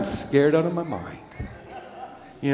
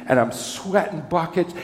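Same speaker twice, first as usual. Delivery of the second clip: normal talking voice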